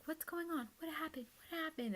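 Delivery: high anxious mom asking what happened; worried mom. Retold or imitated. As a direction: imitate mom rushing to ask what's wrong. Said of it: "She was like".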